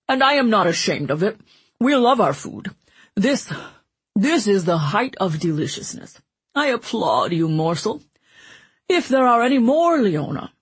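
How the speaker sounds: jovial glutton voice; Mancini voice. A narrator's reading. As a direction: boom it out like a greedy jester. Mancini savouring the muffin.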